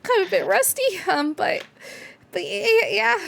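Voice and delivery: high, halting and bashful; shy Gouda. Acted. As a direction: stumble shyly over the words. GM voices Gouda.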